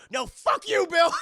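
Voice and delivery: high, loud shouted retort; angry shout. Retold or imitated. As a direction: yell back at the ghost. Ed shouting at ghost.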